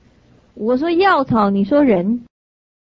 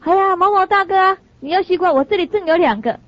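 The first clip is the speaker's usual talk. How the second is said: 年轻人的声音；声音拔高的热情小伙子；像个殷勤的年轻人那样喊大哥；高声热络地招呼; 9 semitones higher than usual. Retold or imitated. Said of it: "年轻人的声音就说".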